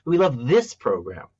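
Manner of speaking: this punched up in pitch; stress on this. Shown as a demonstration. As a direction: hit the word this, as if comparing programs. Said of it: "compared to a different one".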